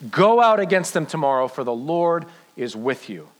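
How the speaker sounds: measured, slowed reading of a Bible passage; scripture reading. Acted. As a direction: read the verse like a promise to the room.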